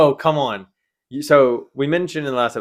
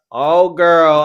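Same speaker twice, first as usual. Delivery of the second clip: loud, higher mock gush; gushing admirer; 7 semitones higher, 10 dB louder than usual. Imitating the man reacting to her accent, loud gushing. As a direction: imitate him exclaiming with delight. Retelling an American's flirty reaction.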